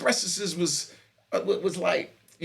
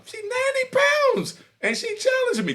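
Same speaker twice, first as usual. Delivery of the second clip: incredulous. Real, not acted. Outraged at tiny woman's challenge.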